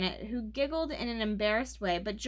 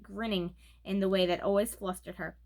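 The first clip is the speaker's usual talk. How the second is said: plain reading voice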